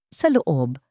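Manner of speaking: usual speaking voice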